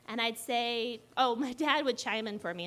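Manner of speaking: ordinary talk, nothing marked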